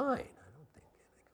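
a hushed self-reassuring whisper; whispered aside. Retold or imitated. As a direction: whisper it like a private thought. Inner thought in retold story.

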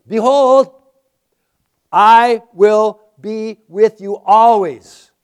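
loud, slow and ringing; booming proclamation. Acted. Preacher booming the promise to the congregation. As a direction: proclaim it to the whole room. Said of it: "listen up".

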